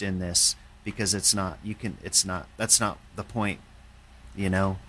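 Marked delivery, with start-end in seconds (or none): none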